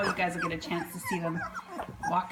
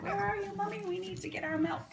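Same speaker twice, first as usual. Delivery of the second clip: puppy voice; 11 semitones higher, 4 dB quieter than usual. Pretend. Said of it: "They're like".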